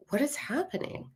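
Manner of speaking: high, soft, baffled question; bewildered. Retold or imitated. Woman telling how she heard unseen children laughing, bewildered. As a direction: ask it in hushed, high bewilderment. Bewildered reaction to strange sounds.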